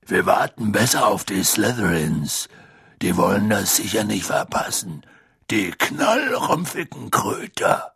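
leicht gespielter gutmütiger Riese; Hagrid. A narrator's reading. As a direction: freundlich brummiger Wildhüter. Hagrid figure voiced by narrator. (Hagrid, gutmütig und stolz)

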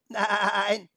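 high goat voice with wobbling vowel; bleating goat. A narrator's reading. Goat contradicts Felix, bleating. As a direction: bleat the word out. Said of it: "mit ihrer meckrigen Stimme".

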